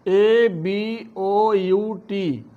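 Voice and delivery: usual speaking voice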